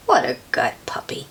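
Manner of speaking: soft low hushed aside; whispered praise. Acted. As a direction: whisper it fondly. Reader praises dog aside.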